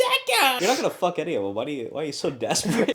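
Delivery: high, loud, fast mock outrage; exasperated yell. Real, not acted. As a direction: push back loudly at the teasing. Exasperated at co-host's teasing.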